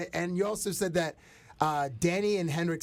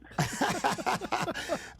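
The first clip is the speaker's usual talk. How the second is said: high laugh; 11 semitones higher than usual. Real, not acted. Amused by the Swedish quote.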